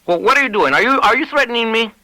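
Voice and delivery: high, rattled man stammering back; flustered rancher caught off guard; Lavadier alarmed. Acted. Lavadier backing away, frightened.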